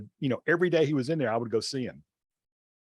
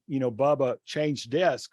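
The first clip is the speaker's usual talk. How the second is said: normal talking voice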